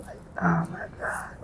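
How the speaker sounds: breathy awed whisper, barely voiced; whispered wonder. Real, not acted. Marvelling at food, she drops to an awed whisper. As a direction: hush it like a secret delight.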